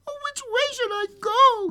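put-on very high childlike voice; tiny high-pitched girl voice; wondering little girl. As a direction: wonder aloud like a lost child. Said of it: "she wondered".

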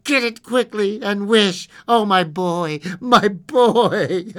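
loud, high desperate plea, breaking into sobs; panting. A narrator's reading. Wife begs for her dead boy, panting. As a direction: plead breathlessly, then sob. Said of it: "she panted".

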